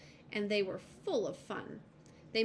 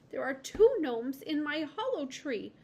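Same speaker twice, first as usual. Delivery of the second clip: high, louder miserable lizard; 6 semitones higher, 5 dB louder than usual. A narrator's reading. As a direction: lizard upset about its tree. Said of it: "the lizard said miserably".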